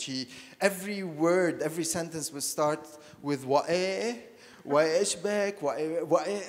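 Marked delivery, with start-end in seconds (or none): 3.5-6.4 put-on heavy Beiruti accent. Recalling great-aunt Shafi'a's speech as a child, a light imitation of a heavy Beiruti accent. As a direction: mimic the old aunt's thick Beiruti exclamations. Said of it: "she spoke in this very heavy Beiruti accent".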